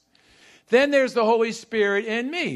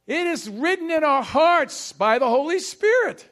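higher-pitched, driving sermon delivery; emphatic preaching; 4 semitones higher than usual. Real, not acted. Preacher teaching church about the Holy Spirit, emphatic preaching. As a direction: preach it high, phrase by phrase, with punched key words.

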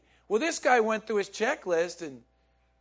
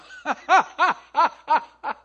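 loud high burst of laughter; big laugh; 9 semitones higher, 11 dB louder than usual. Real, not acted. Preacher laughing with the congregation, loud.

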